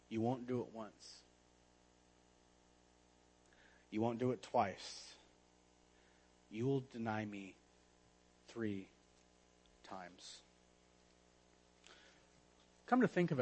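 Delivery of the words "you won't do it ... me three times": solemn quiet Jesus. Acted. Jesus solemnly warns Peter.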